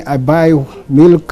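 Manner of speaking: normal talking voice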